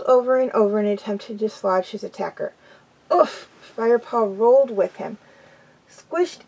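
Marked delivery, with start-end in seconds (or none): 3.1-3.5 short, high-pitched grunt of impact; squashed oof. Acted. Firepaw squashed beneath the heavy body, oof. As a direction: knock the wind out on an oof.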